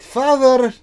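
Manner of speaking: high, loud daughter's shout; sharp, exasperated call across a workshop. Acted. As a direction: shout to get your father's attention. Daughter calls her distracted father.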